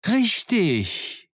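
high, excited cry; thrilled high-pitched call; excited exclamation. A narrator's reading. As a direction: burst out in excited agreement, pitch way up. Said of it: "rief Silver jetzt ganz aufgeregt".

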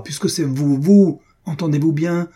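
indignant outburst; accusing. A narrator's reading. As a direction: throw the blame back, voice rising. Heated accusation in narrated quarrel.

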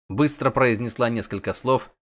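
usual speaking voice

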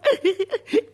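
high, loud mischievous cackle; gleeful kobold giggle. Acted. Kobold gloats over escape.

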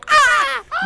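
sudden high scream of fright; a loud, high fright scream; scared yell. Retold or imitated. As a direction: shriek like you just saw a ghost. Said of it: "pego un grito masculino, pero un grito de miedo".

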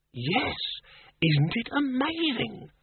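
high, slow, drawn-out wonder; amazed. Acted. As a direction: marvel at it, stretching the words high. Wrangler marvels at miracle of existence.

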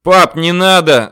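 loud, high, drawn-out cry; desperate yell. A narrator's reading. Son begs his father to stop, yelling. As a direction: yell a pleading warning. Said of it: "заорал я".

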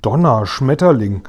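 plain reading voice

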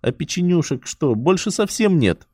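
put-on high whiny child, pitch climbing; whining. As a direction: whine it like a small girl. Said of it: "ноющим голосом повторила Юля".

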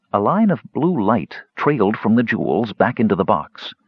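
ordinary delivery

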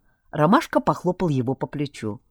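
ordinary delivery, nothing marked

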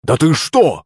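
high-pitched, mildly shocked question; indignant cry. A narrator's reading. Servant reacts to a slap, indignant cry. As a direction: exclaim in narrated indignation. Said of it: "вскрикнул Захарыч".

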